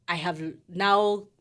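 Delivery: ordinary talk, nothing marked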